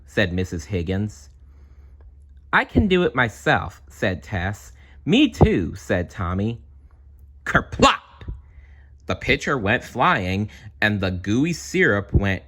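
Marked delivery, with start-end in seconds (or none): 2.5-3.8 high, piping, stubborn little voice; toddler insisting. Acted. Tess refuses help with syrup, high and stubborn. 5.0-5.7 high, louder piping echo; toddler voice. Acted. Tommy copies his sister, high and piping. 7.4-8.0 high, loud splash word; sound effect. A narrator's reading. Narrator reads the spill sound to a child, high and loud.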